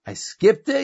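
amused disbelief. Retold or imitated. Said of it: "So he starts laughing".

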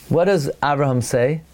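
usual speaking voice

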